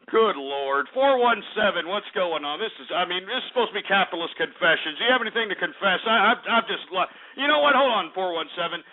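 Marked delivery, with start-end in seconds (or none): none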